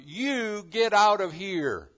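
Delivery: loud, high, insistent orders; God ordering Abram to leave, loud and firm; commanding voice of God. Acted. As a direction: drive each get out home. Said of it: "where God says to Abram".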